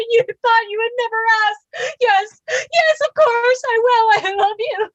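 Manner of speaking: overjoyed acceptance through tears; tearful joy. Acted.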